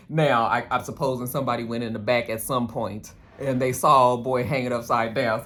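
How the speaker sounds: ordinary talk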